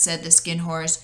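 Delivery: usual speaking voice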